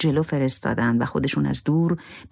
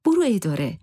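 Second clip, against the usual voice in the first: higher-pitched, insistent plea; emphatic urging; 9 semitones higher than usual. A narrator's reading. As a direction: urge him firmly, voice lifted well up. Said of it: "زهره با تأکید گفت". (با تأکید و اصرار)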